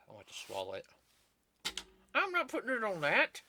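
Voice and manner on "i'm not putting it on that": high, whiny refusal; high mock whine. Refusing to park the gum.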